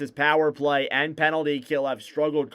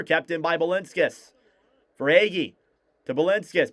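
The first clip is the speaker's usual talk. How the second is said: play-by-play; 3 semitones higher than usual. Acted. Live hockey play-by-play.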